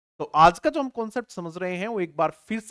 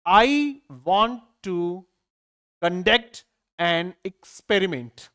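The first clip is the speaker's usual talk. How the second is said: slow dictation; much slower than usual. Shown as a demonstration. Teacher dictates the sentence slowly for the class. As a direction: read it word by word, very slowly. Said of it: "answers भी फटाफट करते जाएंगे".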